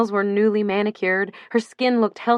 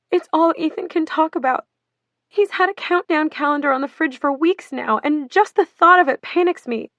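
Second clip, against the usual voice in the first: wailing; 8 semitones higher than usual. Acted. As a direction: build to a wail of panic. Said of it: "she wailed".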